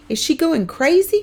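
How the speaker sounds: high, loud frightened question; a boy scared for his mother; dismayed. A narrator's reading. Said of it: "Kermit says in dismay".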